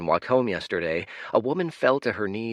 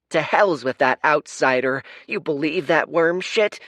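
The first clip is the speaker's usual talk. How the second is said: loud, higher scornful jeer; angry heckler; 7 semitones higher, 7 dB louder than usual. Acted. Hostile crowd member jeering the hero, loud and scornful. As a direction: sneer at the outsider.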